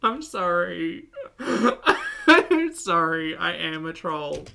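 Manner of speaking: slow, giggly apology; sorry but still giggling; laughing apology. Real, not acted.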